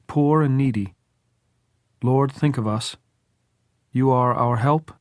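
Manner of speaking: deliberate prayer closing on amen; measured prayer. A narrator's reading. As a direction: intone the psalm in short, spaced phrases. Tom resumes his graveside psalm.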